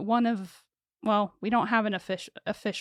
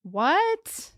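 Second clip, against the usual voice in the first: high, incredulous what; sarcastic shock; 8 semitones higher, 3 dB louder than usual. Real, not acted. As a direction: fake outrage, pitched up. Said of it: "I'm being sarcastic".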